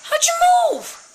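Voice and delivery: very high, loud shriek of alarm; startled shriek. Real, not acted. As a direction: shriek it at the thing that moved. Statue moved, startled player.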